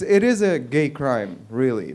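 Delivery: ordinary delivery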